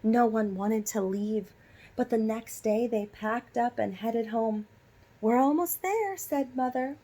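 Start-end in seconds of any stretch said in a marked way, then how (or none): 5.2-6.2 high and louder, brightly; cheerful announcement. A narrator's reading. Mother telling the family they are nearly home, high and louder. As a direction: announce the arrival with glee.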